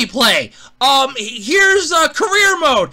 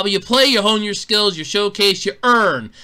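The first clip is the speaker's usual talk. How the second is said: ordinary talk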